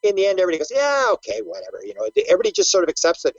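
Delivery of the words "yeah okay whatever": mock resigned acceptance. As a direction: shrug it off as the crowd would. Acts out everybody's grudging acceptance.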